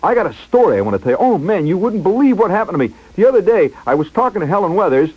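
rushed, high-pitched eager buildup; all excited. Retold or imitated. Schwartz teases his story about Helen Weathers, all excited. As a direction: imitate a friend bursting to tell a story. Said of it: "he was all excited".

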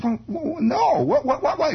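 put-on high, flustered stammering kid; startled stammer. Boy startled by classmate's gossip, high flustered stammer.

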